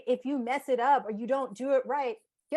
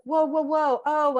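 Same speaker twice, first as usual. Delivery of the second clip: louder, higher whoas of catching herself; mock startled; 6 semitones higher, 7 dB louder, slower than usual. Therapist showing how she catches her reaction, startled. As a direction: pull up short like noticing a jolt. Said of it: "I was like".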